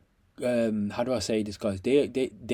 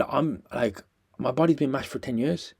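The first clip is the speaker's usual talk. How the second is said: ordinary talk, nothing marked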